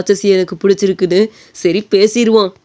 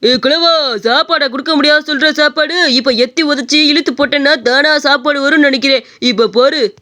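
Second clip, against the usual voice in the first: put-on rough drunk picking a fight, rude and taunting; drunk bully; 4 semitones higher than usual. Drunk man demanding meat from the old shopkeeper, taunting. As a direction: slur out insults at the old man.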